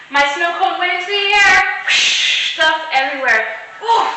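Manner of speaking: high, loud storytelling burst; dramatic fall. A narrator's reading. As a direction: act out the tumble.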